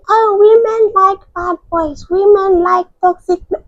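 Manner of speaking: high, mocking imitation of men; a scornful impression of know-it-all men. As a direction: parody the smug men who say it. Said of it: "men have the audacity to say".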